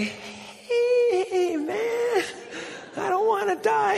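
very high, frightened falsetto; terrified passenger, hammed-up high voice; scared squeal. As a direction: plead for your life in a squeaky mock panic. Scared passenger in speeding car.

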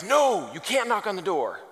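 alarmed warning. Acted. Salesman stopping his partner at the door, mock alarm. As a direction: act out urgently stopping your partner. One salesman urgently stops the other.

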